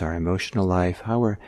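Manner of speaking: ordinary talk, nothing marked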